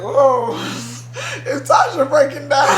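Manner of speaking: high, loud imitation of shocked family; mimicking their startled disbelief, laughing; mock surprised gasp. Family reacting to her crying, mock shocked high voice. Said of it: "they're like".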